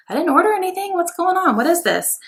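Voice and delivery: high, puzzled retold exclamation; surprised. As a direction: replay your confused surprise at the mystery box. Retelling her reaction to a surprise package.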